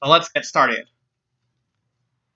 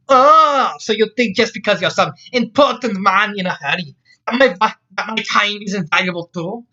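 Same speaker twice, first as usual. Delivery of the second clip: offended Scots outburst; 7 semitones higher, 4 dB louder than usual. Acted. Scotswoman offended by Jack's hurry, scolding. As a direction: scold him in a raised Scots accent.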